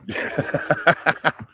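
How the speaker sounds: breathy unvoiced giggle. Real, not acted. Amused at his own joke to an online friend, breathy giggle.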